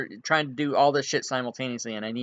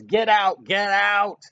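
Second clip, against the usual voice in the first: loud, furious shouts; screaming; 6 semitones higher, 5 dB louder than usual. Acted. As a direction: scream him out of the shop. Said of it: "and he screams".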